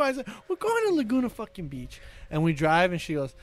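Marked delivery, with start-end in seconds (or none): 0.5-2.0 exasperated answer. Retold or imitated. Retold exasperated answer to repeated question.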